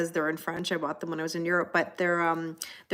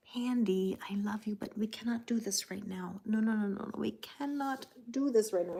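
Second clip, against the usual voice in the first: whisper to the cat; 2 semitones higher, 7 dB quieter than usual. Real, not acted. Hushing the cat on camera.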